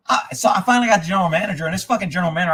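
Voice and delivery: ordinary talk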